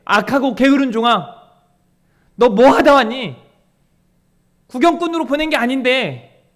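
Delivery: loud, stern scolding master; stern rebuke. Acted. The Lord rebuking a lazy servant, loud stern scolding.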